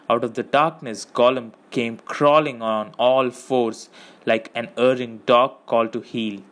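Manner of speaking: plain reading voice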